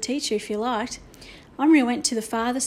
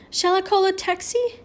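high, concerned question; shocked; 8 semitones higher than usual. A narrator's reading. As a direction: ask in shock, pitched well up. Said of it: "in a shocked voice".

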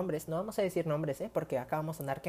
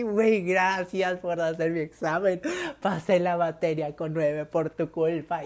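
mock crying; 6 dB louder, slower than usual. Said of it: "y así yo llorando".

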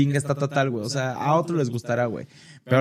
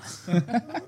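cracking up mid-line; 7 semitones higher, 6 dB quieter than usual. Real, not acted. Ataque de risa.

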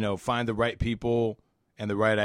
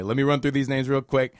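ordinary talk, nothing marked

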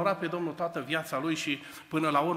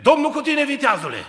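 high, loud, proclaiming greeting; angel's booming greeting; 10 semitones higher, 12 dB louder than usual. Acted. Angel greeting Gedeon at the threshing floor, loud. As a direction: boom it out like a heavenly messenger.

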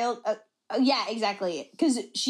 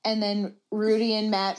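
usual speaking voice

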